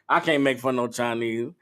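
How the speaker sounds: normal talking voice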